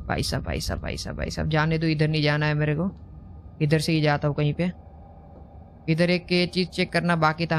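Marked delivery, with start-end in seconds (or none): 0.1-1.5 low, soft, repeated mutter; nervous muttering. Real, not acted. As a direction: mutter anxiously under your breath. Afraid to go that way. (डरते हुए धीमी बुदबुदाहट)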